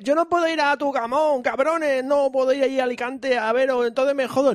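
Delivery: high, mock complaining listener; whiny listener voice. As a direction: whine it like a stranded fan. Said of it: "y diréis".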